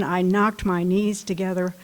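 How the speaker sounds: ordinary delivery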